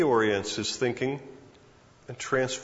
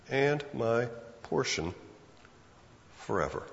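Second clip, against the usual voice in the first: quiet reflective repeat; repeated softly; 3 semitones lower, 6 dB quieter than usual. Shown as a demonstration. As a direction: savour "And my portion forever" as a hushed echo.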